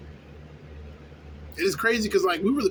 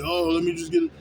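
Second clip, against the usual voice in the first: mocking pushy customer; 13 semitones higher, 4 dB louder than usual. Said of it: "They be like".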